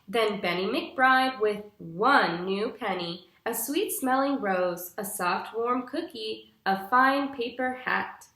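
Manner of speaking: bright, clear storybook reading for a class; patient storytime narration for young listeners; reading to children. Acted. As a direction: read it like a picture book to little kids. Teacher reads picture book aloud.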